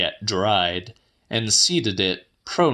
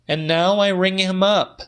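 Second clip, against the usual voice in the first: voiced much higher as the woman; Celia's voice; 11 semitones higher, 3 dB louder than usual. A narrator's reading. Woman telling the old man her news, higher voice. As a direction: lift the pitch well up for her lines.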